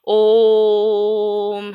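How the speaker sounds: ओम with the O held very long on one level note, closing into m; prolonged Om. Shown as a demonstration. Teacher demonstrating the triple-length vowel with Om, hugely drawn out. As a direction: chant ओम loud and long, in a steady monotone. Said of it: "हम ओम का उच्चारण करते हैं".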